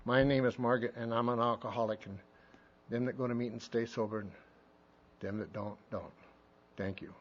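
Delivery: low, soft, halting mock testimony; lame meeting share. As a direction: mumble through a dull meeting share, low and quiet. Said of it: "What a lame message".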